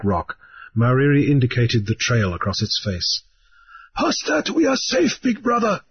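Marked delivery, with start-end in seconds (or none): 4.0-5.8 high, relieved voice; high, hushed reassurance; reassuring islander. A narrator's reading. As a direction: promise safety ahead. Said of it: "he said".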